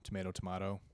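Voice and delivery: tomato as "to-MAY-to", then "to-MAH-to"; tomato with long a, then broad a; two pronunciations. Shown as a demonstration. Arguing it is just pronunciation, tomato two ways. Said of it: "just how you pronounce".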